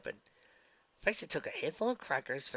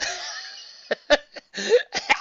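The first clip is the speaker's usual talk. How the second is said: high laughing fit; 14 semitones higher, 8 dB louder than usual. Real, not acted. Reader breaks up laughing.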